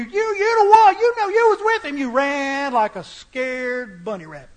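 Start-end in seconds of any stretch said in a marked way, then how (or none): 0.0-2.0 higher, louder, lightly voiced girl pointing a finger; a mock little girl calling him out; the preacher voices the little girl accusing Peter. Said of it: "that little girl asked you".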